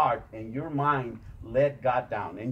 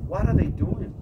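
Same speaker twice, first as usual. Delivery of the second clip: louder and higher, rallying the crowd; rousing shout; 8 semitones higher, 10 dB louder than usual. Real, not acted. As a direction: call it out to fire them up. Rallying the audience.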